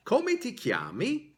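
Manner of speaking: "Come ti chiami" with an upward inflection on "chiami"; rising question intonation. Shown as a demonstration. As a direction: lift the end of "chiami" as a question. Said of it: "there is a rising intonation, an upward inflection on the last word".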